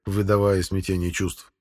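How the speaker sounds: usual speaking voice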